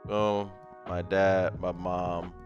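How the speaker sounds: ordinary talk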